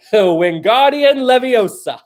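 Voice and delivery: hammed-up wizard incantation; mock spell-casting. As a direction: cast the spell playfully, stretching the words.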